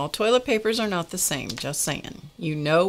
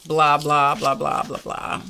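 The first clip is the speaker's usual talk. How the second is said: dismissive mock chatter; bored mimicking drone; mocking blah blah; 2 semitones lower than usual. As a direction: rattle off the blahs like nagging noise. Mocking what was said.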